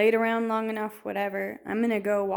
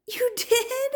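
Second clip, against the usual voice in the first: high, breathless "you did?" in disbelief; surprised. Retold or imitated. Wife hearing her husband already shot his deer, surprised. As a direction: caught off guard, half laughing.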